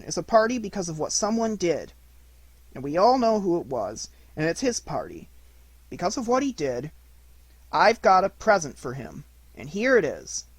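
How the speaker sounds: put-on a child proudly making a speech; Christopher Robin's voice. A narrator's reading.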